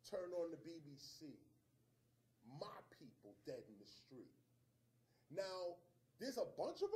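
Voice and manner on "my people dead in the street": low, soft, near whisper; hushed grief. Real, not acted. Seeing Baltimore on the BBC, a soft hushed whisper. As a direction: let it drop, stunned.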